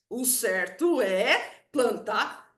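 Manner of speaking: slow, measured "O certo é plantar", spaced out word by word; emphatic decree. Retold or imitated. Dad repeating his ruling on planting, slow and emphatic. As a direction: draw out "é" before landing on "plantar". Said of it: "ele enfatiza aquilo".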